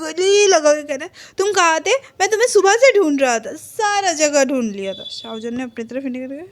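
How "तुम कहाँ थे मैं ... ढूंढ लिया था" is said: high, loud, worked-up reunion voice; excited relief. Retold or imitated. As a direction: burst out as if finding a long-sought friend. Friend hugs him after searching. (बेचैनी भरे उत्साह से)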